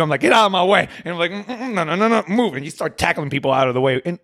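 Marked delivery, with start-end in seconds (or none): 0.2-0.9 higher, louder get-out-of-my-way; mock urgent brush-off. Server hurrying with steak sauce, pushing past coworkers, higher and louder. Rushing through the dining room. 1.3-2.5 high playful no-no-no, laughing a little; mock dismissive refusal. Server dodging coworkers on the floor, high laughing brush-off. Rushing through the dining room.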